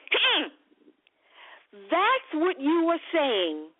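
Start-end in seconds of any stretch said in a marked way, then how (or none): 0.1-0.5 mock Italian hmm. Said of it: "they give you that arm up in the air and they go".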